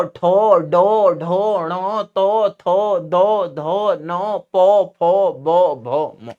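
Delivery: Bihari-style letters with a long rounded 'o'; Bihari pronunciation. Shown as a demonstration. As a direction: chant the alphabet in a drawn-out Bihar accent. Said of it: "इसका उच्चारण कैसे करते हैं बिहार में?".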